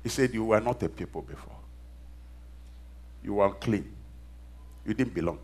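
low, soft, spaced-out recital; quiet preaching. Real, not acted. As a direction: bring the sermon right down, phrase by phrase. Quietly preaches the verse again.

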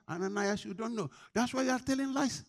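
high-pitched, forceful preaching; heated rebuke. Acted. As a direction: confront them from the pulpit, voice climbing.

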